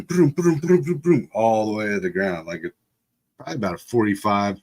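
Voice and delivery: ordinary delivery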